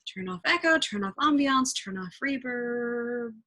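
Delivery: ordinary delivery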